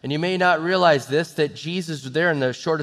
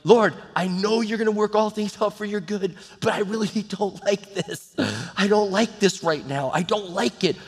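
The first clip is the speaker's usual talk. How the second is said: higher-pitched, half-laughing protest; pleading prayer; 4 semitones higher than usual. Retold or imitated. Preacher retelling his own prayer to the Lord, mildly protesting. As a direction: complain to God with a touch of exasperation. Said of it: "I prayed this, Lord, like".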